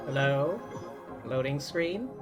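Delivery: normal talking voice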